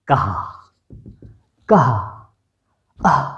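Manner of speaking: कहा with a breathy throat h; आह breathed from the glottis; throat sound demo. Shown as a demonstration. Teacher demonstrates to pupils, throaty कहा. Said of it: "कंठ द्वार से कहा".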